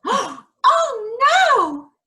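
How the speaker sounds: high alarmed gasp; gasp of dismay. Acted. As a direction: gasp as the ball drops. Ball falls in pond.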